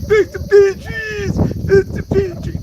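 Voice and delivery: very high, loud, drawn-out heckle; imitated fan yell. Fan mocking a supposed Patriots supporter, imitated high yell. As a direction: stretch it out like a mocking stadium chant. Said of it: "He's like".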